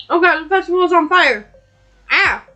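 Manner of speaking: alarmed. Acted. Character panics about fire.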